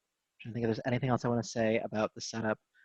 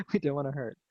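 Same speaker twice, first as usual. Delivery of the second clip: higher, quicker, laughing restatement; amused chuckle; 4 semitones higher, faster than usual. Real, not acted. Yoga teacher chuckling over her repeated words, laughing. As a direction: say it through a little laugh. Chuckles at own stumbled repetition.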